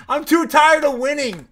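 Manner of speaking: high, loud, laughing boast; laughing brag. Real, not acted. Streamer gloating to chat, loud laughing brag. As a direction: crow it through laughter. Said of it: "I just can't".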